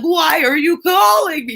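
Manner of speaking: high, loud, irritated shout; worked-up snap. Retold or imitated. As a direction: yell at the caller, fed up. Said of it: "we're like".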